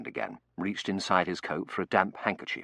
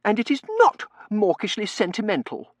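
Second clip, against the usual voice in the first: high, loud, cross contradiction; indignant young lady's retort; huffy rebuttal; 7 dB louder than usual. Acted. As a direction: deny it firmly in an affronted voice. Tossie rebuts the butler angrily.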